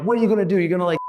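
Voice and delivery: confrontational. Retold or imitated. Speaker in the driver's face, taunting. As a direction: dare him to act.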